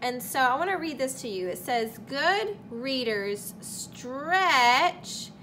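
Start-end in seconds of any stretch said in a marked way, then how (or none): 2.1-5.3 very slow, stretched words; reading the rule slowly, stretching every word. Shown as a demonstration. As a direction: draw each word out like a rubber band. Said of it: "like a rubber band and stretching it out".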